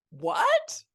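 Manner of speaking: high, loud startled squawk of disbelief; a mother stunned by her daughter's confession; shocked what. Retold or imitated. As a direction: shriek it like you can't believe your ears. Mom reacts to dreadlocks news.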